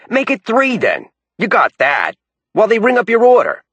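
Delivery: high, pushy raspy New Yorker; New York accent. A narrator's reading. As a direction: haggle hard, New York style. Bell's dialogue.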